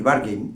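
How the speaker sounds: বার্গেন said with a long "gain" ending; wrong pronunciation. Shown as a demonstration. Teacher citing the mistaken version, wrong pronunciation. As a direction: say বার্গেন as "bar-GAYN". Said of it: "ভুল উচ্চারণও যদি বলি".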